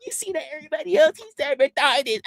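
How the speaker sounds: high, soft, breaking laughter; laughing. Real, not acted. Streamer laughing at a chatter's insult. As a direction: cackle while repeating it.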